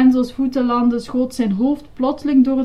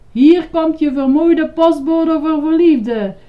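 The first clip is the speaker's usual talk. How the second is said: high, loud announcing call; 7 semitones higher, 8 dB louder than usual. A narrator's reading. Grover flying over the wall, calling out. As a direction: shout it while flying past. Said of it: "riep hij".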